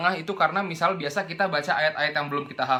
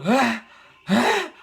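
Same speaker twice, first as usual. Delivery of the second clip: exaggerated airy "Hah?" with the second much higher; overblown breathy hah; 6 semitones higher, 3 dB louder than usual. Shown as a demonstration. Teacher mocks the wasteful h sound with exaggerated hahs. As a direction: blow far too much air on each hah.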